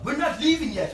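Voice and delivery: high, loud mock panic; panicked yell. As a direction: yell it like he's startled. Friend panics in retold bar story.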